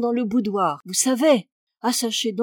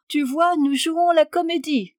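higher and louder, playfully triumphant; little conquering air; 7 semitones higher, 4 dB louder than usual. A narrator's reading. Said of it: "d'un petit air conquérant". (petit air conquérant, plus aigu et plus fort)

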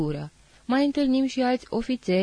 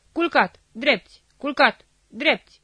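loud, higher drill shouts; thundering commands; 6 semitones higher, 6 dB louder than usual. Acted. Officer drilling the soldier, thundering loud commands. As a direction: bark the drill orders like thunder. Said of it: "pe urmă comanda tună".

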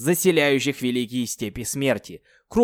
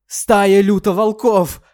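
high, loud, slow panicked yell; desperate scream; 9 semitones higher, 10 dB louder, slower than usual. Acted. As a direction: scream in terror. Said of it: "отчаянно заорал".

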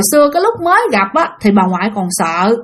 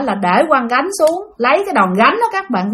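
ordinary talk

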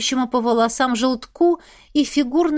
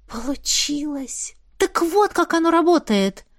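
delighted gasp, then rising joy; thrilled that it worked; excited; 5 semitones higher than usual. Acted. Fire lights at her words.

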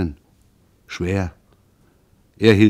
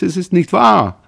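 desperate plea; 9 semitones higher, 5 dB louder than usual. A narrator's reading. Son pleads with sobbing mother.

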